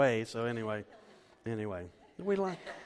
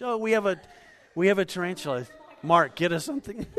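suddenly higher and louder, startled; startled alarm; 6 semitones higher, 7 dB louder than usual. Real, not acted. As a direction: jump as if a spider just appeared. Tarantula appears during sermon.

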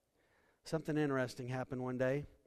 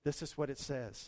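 ordinary delivery

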